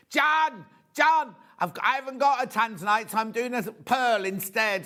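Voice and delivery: high, loud calling out John, then settling down; excited producer. Retold or imitated. Producer calling to John about the missing tanzanite, high and loud. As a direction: call across the studio, eager to announce the swap.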